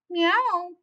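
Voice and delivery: a bright playful kitten mew; cat meow. Acted. The cat playing with Mister Flugel's robe, a high meow.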